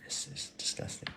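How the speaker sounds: hushed whisper of mock disgust; mock disgusted granddad. Granddad tasting sweetener in his tea, whispered mock disgust. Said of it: "he'd probably went".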